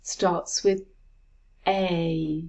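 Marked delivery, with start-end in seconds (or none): 1.7-2.4 a held long, as in say; sustained teaching-demo "a"; long vowel. Shown as a demonstration. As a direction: say "a" as a drawn-out sound rhyming with say. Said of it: "long vowel, as in say".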